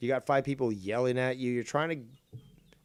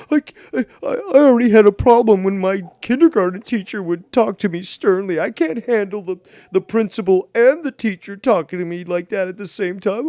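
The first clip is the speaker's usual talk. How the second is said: sarcastic whiny mockery; 7 semitones higher, 10 dB louder than usual. Sarcastic mock of soft people.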